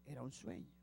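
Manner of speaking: hushed aside to the congregation; soft reminder. Shown as a demonstration. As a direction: drop your voice to remind them. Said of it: "porque recuerden".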